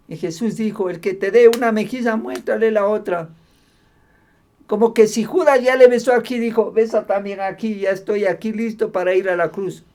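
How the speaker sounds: loud, high-pitched sermon delivery; forceful preaching. Acted. Preacher retelling the arrest of Jesus, forceful preaching. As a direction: drive the story home to the congregation.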